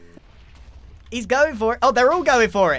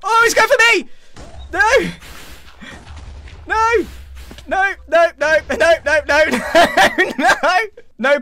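high, loud panicked cries; frantic repeated yelps; 6 semitones higher, 3 dB louder than usual. Real, not acted. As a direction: cry out as it comes straight for you. Chased by the monster.

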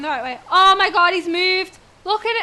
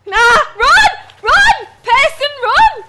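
loud, higher frantic yelling; panicked shouting; 8 semitones higher, 12 dB louder, slower than usual. Real, not acted. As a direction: yell at them to run. Urging character to run.

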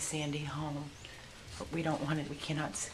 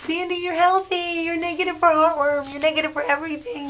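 loud, much higher happy cooing; 13 semitones higher, 14 dB louder than usual. Real, not acted. Rescuer telling the mother dog she is healthy in excited baby talk. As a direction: gush the good news to the dog.

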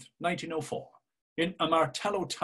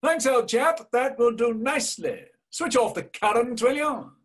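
much higher and louder, breezy mock thanks; jaunty Buck Mulligan; 9 semitones higher, 5 dB louder than usual. A narrator's reading. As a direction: toss it off brightly to a far-off helper. Buck Mulligan voiced in reading.

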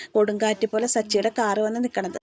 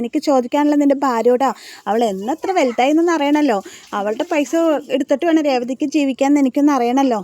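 louder, raised confronting voice; voicing an angry man, loud and raised; retold angry demand; 3 semitones higher, 6 dB louder than usual. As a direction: demand answers like a furious brother. Sachi confronting the family angrily.

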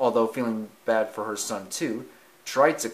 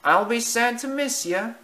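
high, wistful little mouse; sad mouse voice; 12 semitones higher, 4 dB louder than usual. Acted. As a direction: say goodbye sadly. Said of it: "he said sadly".

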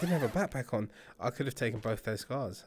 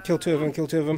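urgent callout; 4 semitones higher, 8 dB louder than usual. Real, not acted.

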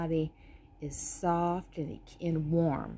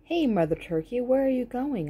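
louder and higher, calling across to her; 6 semitones higher, 5 dB louder, faster than usual. A narrator's reading. Dog calls after the turkey, loud and high. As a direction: call out to the turkey walking away. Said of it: "I called to her".

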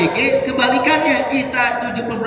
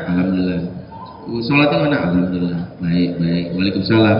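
much lower, terse mumbled replies; curt son. Retold or imitated. Boarding-school son giving father one-word answers, low and terse. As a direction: answer as briefly as possible. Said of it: "Gimana, Nak?".

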